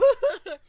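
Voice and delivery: loud high laugh; burst of laughter. Real, not acted. About being broke, laughing out loud. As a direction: crack up at your own joke. Laughs at her own budget joke.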